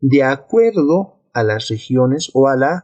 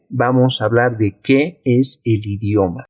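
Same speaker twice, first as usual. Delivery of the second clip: ordinary talk, nothing marked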